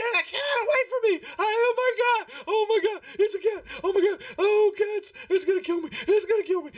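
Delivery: panicked falsetto shrieking; hammed-up hysterical little halfling; screaming halfling. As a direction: squeal in terror, very high all through. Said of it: "she's just, like, screaming".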